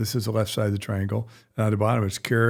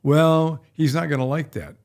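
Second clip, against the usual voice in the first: louder, higher mock protest; mimicked objection; 5 semitones higher, 4 dB louder than usual. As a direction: object on the dog's behalf, leaning on "Well". Voices an objecting dog owner.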